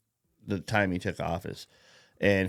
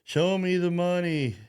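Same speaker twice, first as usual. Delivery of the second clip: higher, punchy catchphrase quote; movie catchphrase; 8 semitones higher than usual. Retold or imitated. Biden voiced demanding cash, punchy movie quote. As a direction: belt the movie line. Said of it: "so he goes".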